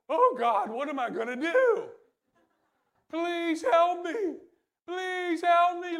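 loud, high pleading cry to God; mock desperate pleading. Israelites at the Red Sea begging God, loud desperate plea. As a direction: beg God for help in panic.

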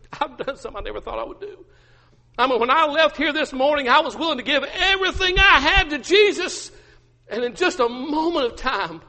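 high, tearful-style confession swelling loud; acted anguish. Peter confessing to his wife, anguished. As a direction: pour it out in rising despair. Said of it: "Peter just begins to weep".

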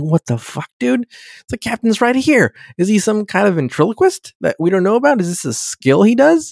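high-pitched, incredulous questioning; mock bafflement. Crew hear captain over intercom while he's present, mock baffled. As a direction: play bewildered crew demanding an explanation.

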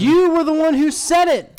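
loud sharp voice; sudden high yell at him. Real, not acted. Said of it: "Whoa, why are you yelling?".

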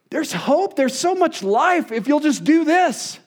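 high-pitched, exasperated plea; frustrated pleading. Retold or imitated. Pastor urging his kids toward hope, frustrated pleading. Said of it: "And I get frustrated".

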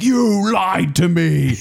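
slow, loud, betrayed roar; mock angry. Retelling Chris Farley raging at the waiter, a loud slow mock roar. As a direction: drag it out, furious at the lie. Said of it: "he starts getting so mad".

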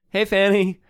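high, loud friendly hail; calling out. Acted. Malaya waving to Fanny on the other side, calling out. Malaya calls to woman across room.

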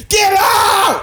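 high, piercing imitated scream; explosive shouted outburst. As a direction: shriek it at full force. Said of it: "She screamed".

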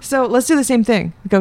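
ordinary talk, nothing marked

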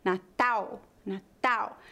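"Natal" ending in a U glide, "na-TAU"; final L as U. Shown as a demonstration. Teacher demonstrating final L, "Natal" said as "na-TAU". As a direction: round the L of "Natal" into a U. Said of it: "a letra L em final de sílabas é pronunciada como U".

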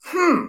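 very high mock "hmm"; squeaked-up imitation of a cashier's hum; retold puzzled hum. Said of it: "What comes out of her mouth?".

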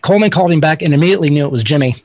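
ordinary talk

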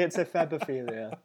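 ordinary talk, nothing marked

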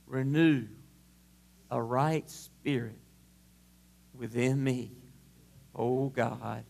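low, hushed prayer; murmured plea. Acted. Prays at sermon close.

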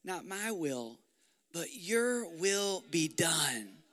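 slow, soft "Not my will"; soft surrender. Acted. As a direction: let the prayer sink into surrender. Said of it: "And Jesus said".

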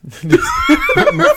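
high burst of laughter; loud laugh. Real, not acted. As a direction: squeal with laughter. Joke about personal page.

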